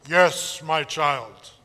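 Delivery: low, booming divine voice; deep voice of God. Acted. God answering the camper in a deep voice. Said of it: "that's what God's voice".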